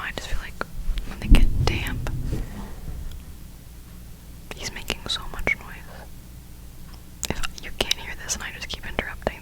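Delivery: ordinary talk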